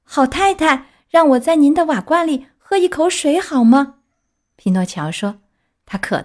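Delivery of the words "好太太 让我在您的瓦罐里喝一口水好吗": put-on high child puppet voice, politely pleading; pleading Pinocchio. Pinocchio begs a kind lady for water, pleading. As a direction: ask sweetly in a raised little-boy voice.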